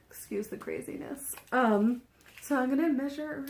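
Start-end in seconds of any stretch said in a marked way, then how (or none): 0.1-1.3 whispered aside. Real, not acted. Aside while throwing hair back.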